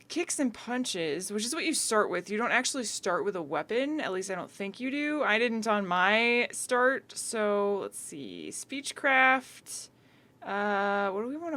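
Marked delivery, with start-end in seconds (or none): none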